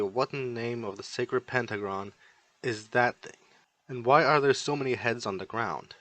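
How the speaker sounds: plain reading voice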